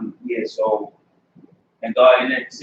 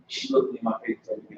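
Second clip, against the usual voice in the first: normal talking voice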